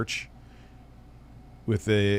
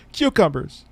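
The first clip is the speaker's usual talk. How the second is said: Ass Man Gold impression; 6 semitones higher than usual. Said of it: "he says it with this inflection".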